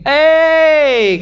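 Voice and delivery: loud, high call of "Hei"; shout from behind a wall. Retold or imitated. Preacher imitating a man calling from behind a wall, loud shout. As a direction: yell "Hei" to get attention over a wall. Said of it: "Ada orang berteriak dari belakang dinding".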